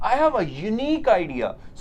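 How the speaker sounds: unique said slowly with a yoo glide; slow example sentence. Shown as a demonstration. Teacher to students, slow model sentence. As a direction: spell out a unique idea word by word. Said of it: "य से कुछ एक example दिए".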